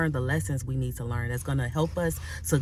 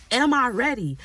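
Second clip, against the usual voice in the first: Am I ready? lifted high and louder, stress on Am; higher, pleading cry; 6 semitones higher, 4 dB louder than usual. Shown as a demonstration. Preacher crying out the question to God. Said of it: "really ask yourself".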